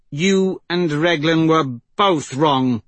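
slow, high, emphatic reproach; angry rebuke. Acted. Persephone rebukes the chieftain, angry and deliberate. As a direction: lay the blame down word by word. She rebukes him, snatching belt.

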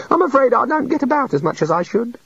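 high, sorry little god; sadly. A narrator's reading. The god explains himself to the wizards, sadly. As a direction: confess it with a sad sigh. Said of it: "said the god sadly".

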